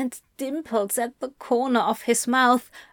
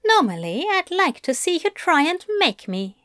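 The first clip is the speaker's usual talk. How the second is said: higher, brisk teasing voice; Aziraphale; 6 semitones higher, faster than usual. Acted. Angel to his bound demon, playful taunt. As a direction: taunt him playfully, voice lifted.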